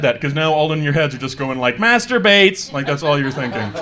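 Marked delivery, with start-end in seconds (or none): 1.8-2.7 loud, raised mock shout; exaggerated, higher-pitched mock outburst; mock exclamation. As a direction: blurt it out high and loud, mimicking the crowd's thought. Mock crowd thought blurted out.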